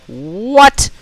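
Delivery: high, drawn-out rising question; incredulous disbelief. Real, not acted. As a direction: let disbelief swoop upward. Caster disbelieves an unfinished upgrade.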